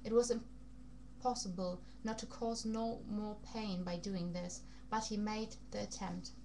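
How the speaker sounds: ordinary delivery, nothing marked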